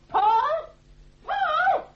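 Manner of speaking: calling out. Acted. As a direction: summon him from another room.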